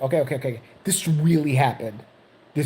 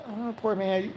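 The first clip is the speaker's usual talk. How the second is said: soft, higher sympathetic coo; pitying onlooker; 5 semitones higher, 8 dB quieter, slower than usual. Acted. Other patients pity the crying old man, soft and higher. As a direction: voice gentle mock pity. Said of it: "the other people across me".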